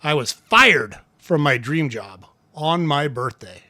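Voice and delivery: slow delivery with "fired" punched; stress on FIRED. Shown as a demonstration. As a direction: hit "fired", then slowly measure out the rest. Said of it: "practice all of those things with your hook".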